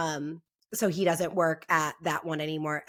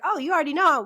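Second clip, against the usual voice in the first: excited reply; 9 semitones higher, 5 dB louder, faster than usual. Retold or imitated. Excited reply to nail tech.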